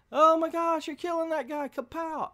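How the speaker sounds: very high squeaky mock shock; hammed-up high-pitched gasping reaction; mock alarm. Reviewer voicing a hero witnessing a killing, mock alarmed.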